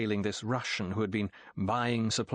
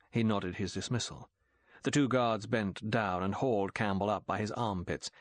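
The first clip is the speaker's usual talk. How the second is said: usual speaking voice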